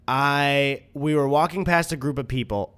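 ordinary talk

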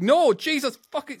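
high, loud panicked mother's yell; mom screaming. Retold or imitated. Mom in a panic after her son gulps the fish, high yell. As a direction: shriek it like a frightened mom. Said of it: "my mom screamed at me".

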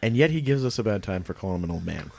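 ordinary talk

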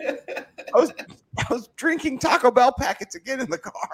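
high-pitched silly confession; high put-on voice.

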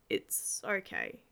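low, slow and soft; gently. Acted. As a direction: reassure her gently and slowly. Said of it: "I said gently".

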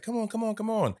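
high, playful urging; coaxing. Retold or imitated. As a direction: egg him on to join in. Coaxing him onto karaoke stage.

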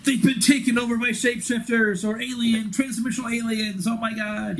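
high-pitched imitation of an alarmed commander; mock alarm. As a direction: play up the alien-invasion panic in a high voice. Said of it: "thinking that there's some sort of shady alien intrusion".

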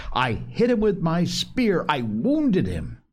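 demanding to be believed; indignant insistence. A narrator's reading. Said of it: "Ralph said indignantly".

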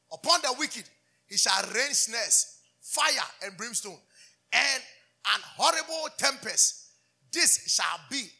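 slow, loud, rising proclamation; fiery scripture reading. Acted. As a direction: call down judgement from the pulpit. Reads Psalm with force.

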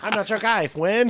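high, loud cartoon retort; mock-offended comeback, loud and high; South Park Canadian. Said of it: "Why is South Park always right".

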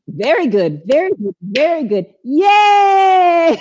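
high, sing-song praise building to a shout; gleeful chanted celebration; excited cheer. Shown as a demonstration. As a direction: cheer them on, ending in a long squeal. Exercise cheer after the chant.

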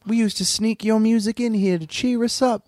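put-on a kid missing the old songs; wistful orphan kid.

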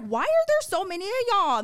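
loud high alarmed question; mock-startled yell at the cops; startled outcry. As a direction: blurt it out in alarm. Retelling surprise at many cops.

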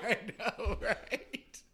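real laughter through the words; high, cracking up while agreeing; laughing. Real, not acted. Joke teller agrees after his punchline, laughing.

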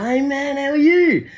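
put-on chummy high drunk bloke; high friendly drunk voice. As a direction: pitch up high, overly friendly slurred greeting. Drunk stranger returns.

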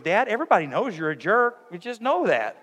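lightly voiced daughter teasing Dad; teasing teen daughter. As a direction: mimic a kid telling off her dad. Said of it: "she goes".

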